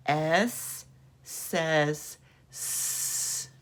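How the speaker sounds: very slow, hissing the final S; slow sentence drill. Shown as a demonstration. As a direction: say S says S slowly for kids to echo. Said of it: "Do it again".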